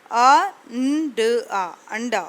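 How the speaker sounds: slow, letter by letter: अ, न, ड, आ, then blended into अंडा; phonics sounding out. Shown as a demonstration. Teacher sounding out अंडा for young Urdu learners, slow phonics. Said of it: "मैं sounds के साथ read करके आपको बताती हूँ".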